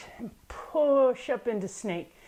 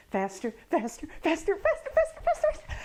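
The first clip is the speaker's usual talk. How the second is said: repeated word racing faster and climbing very high; speeding up; 9 semitones higher than usual. Shown as a demonstration. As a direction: accelerate into a high, frantic squeak. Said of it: "Now let's go faster".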